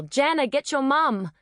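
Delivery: narrated yell, pitched well up. A narrator's reading. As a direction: call out urgently to a friend. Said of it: "and yell".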